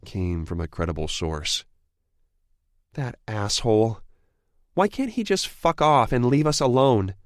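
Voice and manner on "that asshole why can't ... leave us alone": higher, louder furious woman; angry outburst. A narrator's reading. Evie cursing her father to Darius, loud and angry. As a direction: spit it out at her meddling father.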